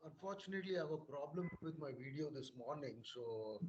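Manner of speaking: very faint, far from the mic; barely audible. Real, not acted. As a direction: speak almost inaudibly quiet. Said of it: "uh, very low in volume".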